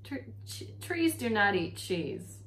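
can't believe what he's hearing; dismayed. A narrator's reading. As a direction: brother baffled by a cheese gift for a tree. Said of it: "looked at her with dismay".

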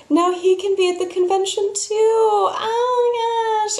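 high gushing squeal, drawn out; squealing excitement. Retold or imitated. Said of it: "she's like".

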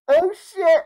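very high, loud exclamation; excited shout. Real, not acted. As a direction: blurt it out in glee. Fan expects Tobey Maguire.

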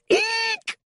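extremely high squeal of fright; tiny high-pitched shriek; squealed "eek". A narrator's reading. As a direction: let out a piercing little eek. Panicked crowd fleeing.

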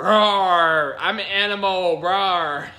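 loud mock roar; animal roar. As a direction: roar it like a beast. Pretending to be animal.

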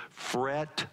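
usual speaking voice

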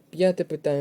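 normal talking voice

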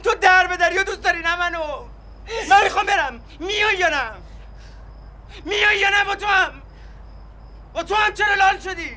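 slow punched insults rising to a yell; angry shouting. Acted. Husband yells at wife, demanding she come, furious. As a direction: spit each insult at her.

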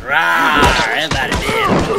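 high, loud victory roar; playful roar. Real, not acted. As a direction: roar it out in triumph. Gloating after a kill.

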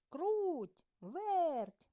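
high loud call across the yard. A narrator's reading. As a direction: call the mice over, bright and loud. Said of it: "покликав півник".

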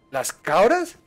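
ordinary talk

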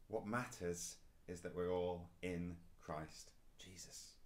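ordinary delivery

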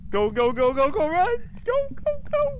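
very high, loud frantic urging; being chased. Real, not acted. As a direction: scream at them to go. Urging friends to run.

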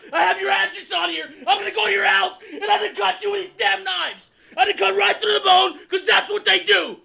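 high, loud, ranting menace into the phone; unhinged screaming threat. Acted. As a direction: lose it completely on the customer.

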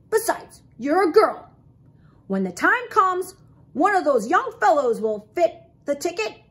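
loud, high, dismissive uncle voice; grumpy Uncle Phil. A narrator's reading. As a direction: reject it forcefully as a grumpy old groundhog.

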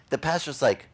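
ordinary talk, nothing marked

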